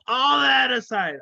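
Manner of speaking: shouted over a laugh, pitch jumping up; loud laughing blurt; loud burst. Real, not acted. Laughing outburst then composure.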